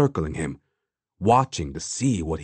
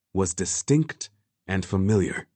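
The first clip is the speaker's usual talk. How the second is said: ordinary delivery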